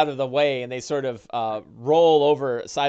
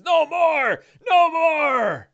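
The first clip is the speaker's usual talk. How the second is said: high, far-off begging cry of no more; pleading orc; 14 semitones higher, 4 dB louder, much slower than usual. Acted. Beaten orc begging the party to stop. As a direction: beg for mercy in a high strained voice. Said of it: "He says".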